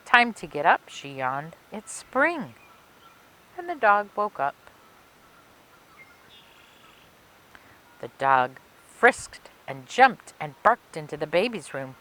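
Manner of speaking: put-on lively read-aloud for children; story narration. A narrator's reading. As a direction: tell it like a picture book for kids. Reading a picture book aloud.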